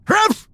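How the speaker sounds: high yappy mock bark; dog bark imitation. Storekeeper barks like a dog.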